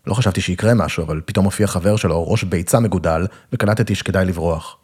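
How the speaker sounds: usual speaking voice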